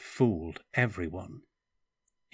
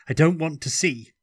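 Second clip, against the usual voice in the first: higher and louder, refusing in horror; panicked cry; 8 semitones higher, 9 dB louder than usual. A narrator's reading. Stefan refusing to look at the wound, panicked cry.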